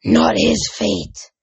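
slow, forceful possessed rasp; deep rasp. A narrator's reading. Possessed mother shaking the boy, slow rasping voice. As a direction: drag it out in a harsh rasp. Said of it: "rasped in a much deeper voice".

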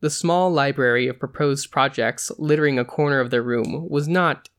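ordinary delivery, nothing marked

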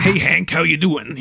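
lightly voiced Boston goblin greeting; Temple Boy. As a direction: greet a fellow goblin in a mild character voice. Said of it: "reference them as their voices".

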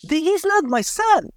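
a father refusing to believe it is his son, voice rising high; stunned disbelief. Retold or imitated. Father sees a stranger's body.

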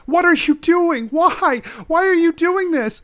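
high, loud anguished wailing; distraught high-pitched shout; sobbing cry. Acted. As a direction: cry out desperately, stretching the words. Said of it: "who's sobbing as she cries".

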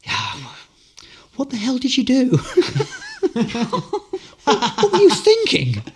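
high laughing mock outrage; teacher's mock scolding. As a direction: scold through laughter, grinning. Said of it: "but with a big smile on his face".

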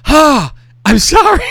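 high, loud, drawn-out retold sorry; mock-panicked apology. Man apologizing to the woman he just punched, mock panic. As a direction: stretch out a high, alarmed apology.

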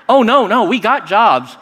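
quick excited no; eager denial. Retold or imitated. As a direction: brush it off eagerly.

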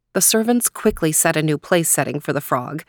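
plain reading voice

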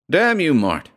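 high, sharp snarl; angry snarl. A narrator's reading. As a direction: snap it out in anger. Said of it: "he snarled".

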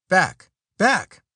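loud urgent shout of warning; cried out. A narrator's reading. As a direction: shout it, pushing them back. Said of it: "cried Stoddard".

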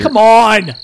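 loud, high protest; exasperated shout. Real, not acted. Podcast host to silent co-hosts after a joke flops, shouting.

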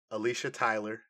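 ordinary talk

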